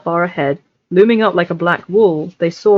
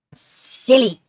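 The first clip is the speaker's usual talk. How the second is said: high, hissing creature voice; hissed Gollum; 6 semitones higher than usual. Acted. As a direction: spit the word like Gollum. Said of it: "hissed Gollum".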